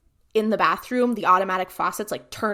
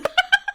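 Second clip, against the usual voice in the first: a high, bursting laugh; laughing at her own punchline; real laugh; 6 dB louder than usual. Real, not acted. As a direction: break into a squeaky laugh.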